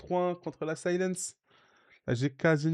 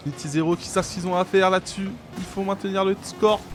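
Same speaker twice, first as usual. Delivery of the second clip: loud, high excited casting; play-by-play commentary; 5 semitones higher, 4 dB louder than usual. Real, not acted. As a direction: call the match finish live. Live match commentary.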